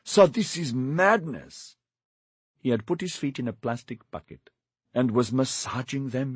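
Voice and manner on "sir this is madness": slow, higher, pleading alarm; shocked servant pleading with his master; alarmed protest. Acted. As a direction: stretch it out in shocked dismay.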